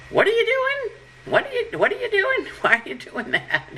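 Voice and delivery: high-pitched, incredulous questioning; questioning women. Retold or imitated. Women in your life questioning you, high-pitched and incredulous. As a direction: squeak it like a puzzled mother catching you. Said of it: "who say to you".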